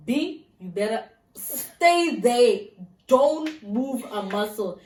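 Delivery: slow, emphatic order; firm warning. Retold or imitated. Stranded caller ordering Linda to stay home, firm. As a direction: spell out the order slowly.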